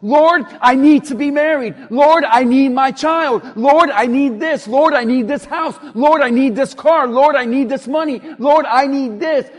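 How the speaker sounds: loud, insistent litany of demands; demanding mock prayer. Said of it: "I'm gonna pray and I'm gonna say".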